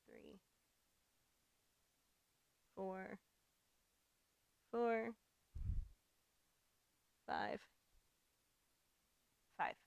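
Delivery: strained, breathless counting; counting while muscles shake; shaking with effort. Real, not acted. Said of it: "My legs are shaking".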